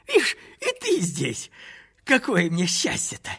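happy to meet a friend; a touch of joyful surprise; cheerful. A narrator's reading. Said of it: "весело сказал он".

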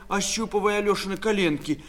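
ordinary delivery, nothing marked

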